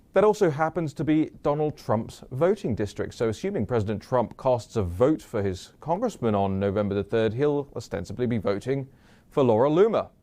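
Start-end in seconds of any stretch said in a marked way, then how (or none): none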